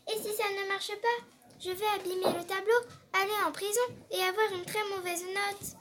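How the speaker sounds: put-on high, hurried fretful boy voice; worried boy. A narrator's reading. Boy fretting to the portrait, high and anxious. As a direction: rush the worries out.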